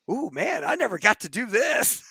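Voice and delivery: high, thrilled exclamation; giddy excitement. Retold or imitated. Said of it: "He was probably so excited".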